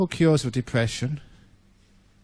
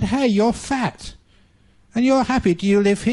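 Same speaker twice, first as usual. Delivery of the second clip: surprised spider; 7 semitones higher, 3 dB louder than usual. Acted. Sad spider notices fat one.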